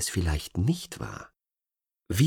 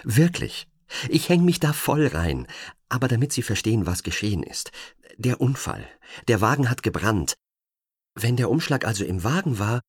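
pitched-up anxious eagerness; forced eagerness; 5 semitones higher than usual. Acted. Agent explains the burnt envelope, nervously eager. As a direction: talk fast to placate him, forced upbeat. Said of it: "ihre Stimme tatenfreudig klingen zu lassen".

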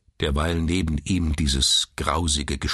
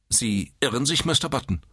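higher-pitched, strict woman's voice; stern nurse; 9 semitones higher than usual. A narrator's reading. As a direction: correct him sternly. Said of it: "sagte die Schwester streng". (strenge Krankenschwester)

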